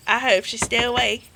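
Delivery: ordinary talk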